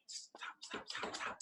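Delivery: urgent whisper; whispered self-scolding. Retold or imitated. Caller whispering at himself to stop copying an accent. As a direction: hiss it under your breath to yourself.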